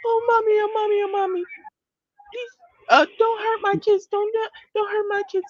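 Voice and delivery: high, wailing mock sob; hammed-up crying to show it is phony; mocking fake tears. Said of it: "her whole".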